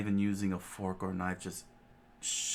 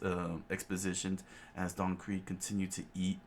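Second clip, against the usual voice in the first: ordinary delivery, nothing marked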